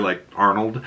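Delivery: ordinary delivery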